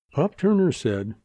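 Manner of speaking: usual speaking voice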